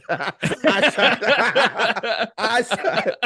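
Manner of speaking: high, loud laughing insistence; laughing protest. Real, not acted. Son laughing at dad taking credit for the kill. Laughing at his dad's claim.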